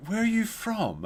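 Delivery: high, sing-song mock question; imitating flamboyant neighbor. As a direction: put on the posh old man asking. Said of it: "the way the guy said it was so flamboyant".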